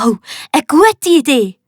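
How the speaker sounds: light up at the plan; young pirate cheering a friend's idea; enthusiastic. A narrator's reading. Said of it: "ruft der Max begeistert".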